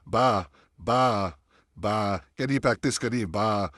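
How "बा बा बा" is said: बा with a clean, straight open aa; बा, a pure drawn-out aa; straight baa demo. Shown as a demonstration. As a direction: say बा with jaw down, lips evenly open. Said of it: "अलिफ मद का sound".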